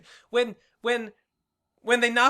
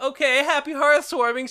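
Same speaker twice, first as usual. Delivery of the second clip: mock teary farewell; 7 semitones higher, 4 dB louder than usual. Voicing pony farewell.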